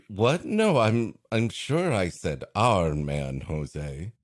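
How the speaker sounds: ordinary talk, nothing marked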